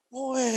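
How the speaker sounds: high imitated baby wail; short mock newborn cry; tangisan bayi. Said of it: "Seperti bayi. Nangis". (tiruan tangis bayi bernada tinggi)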